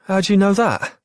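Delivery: high amazed question. Acted. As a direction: ask it in wide-eyed wonder. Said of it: "said an amazed Charlie".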